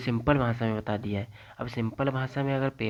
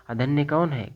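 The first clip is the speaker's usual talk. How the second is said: quiz question; 4 semitones higher, 4 dB louder than usual. Shown as a demonstration.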